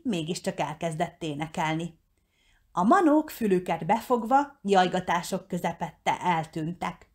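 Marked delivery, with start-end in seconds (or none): none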